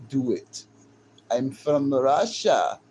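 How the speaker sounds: slow, drawn-out imitated Russian; mock Russian accent. Streamer playing a Russian woman, mock Russian accent. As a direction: stretch out a hammed-up Russian accent. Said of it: "Russian women and shit".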